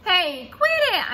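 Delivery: high, loud, slow yell; girl yelling at classmate to stop. Acted. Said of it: "I yelled".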